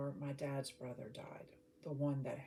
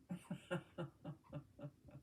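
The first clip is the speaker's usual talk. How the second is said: soft chuckle; 7 dB quieter than usual. Real, not acted. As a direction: laugh softly to yourself in short pulses. Amused at the spaghetti detail.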